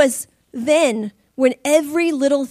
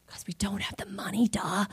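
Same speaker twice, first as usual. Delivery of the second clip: whispered, sarcastic duh; wife whispers a sarcastic answer to her husband; snarky whisper; 5 semitones lower, 11 dB quieter than usual. Retold or imitated. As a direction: hiss it like it's obvious.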